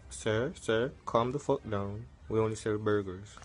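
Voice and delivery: a deep, patient cashier brushing him off; calm counter worker. Acted. Worker calming angry customer.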